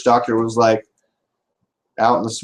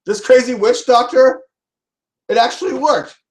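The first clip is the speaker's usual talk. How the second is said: excited guy; 10 semitones higher than usual. Acted. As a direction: gush like he can't believe his luck. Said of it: "He's like".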